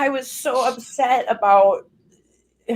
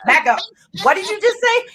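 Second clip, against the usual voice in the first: higher, demanding disbelief; incredulous; 10 semitones higher than usual. Retold or imitated. Rapper confronting the label guy, incredulous. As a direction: make them repeat it. Retold reaction to label offer.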